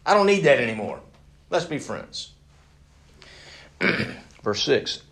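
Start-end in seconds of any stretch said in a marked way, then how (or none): 0.1-2.3 raised, emphatic brush-off from a self-made man; dismissive boasting. Acted. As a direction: wave it all away loudly. Acting a self-made man's dismissal.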